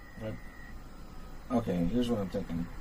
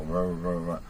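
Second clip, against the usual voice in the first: flat low man's drone; 5 semitones lower than usual. Shown as a demonstration. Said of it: "that isn't musical".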